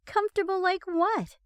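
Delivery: high indignant gripe; griping. A narrator's reading. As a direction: complain with irritation. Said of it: "Becca gripes".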